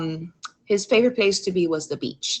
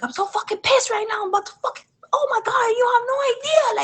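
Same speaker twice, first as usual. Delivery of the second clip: much higher, crying shout of rage; furious outburst. Retold or imitated. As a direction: scream your fury at your brother, near tears. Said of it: "I'm super fucking livid".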